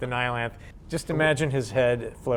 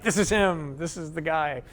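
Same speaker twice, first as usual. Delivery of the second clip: excited; 6 semitones higher than usual. Retold or imitated. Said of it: "And everybody was like".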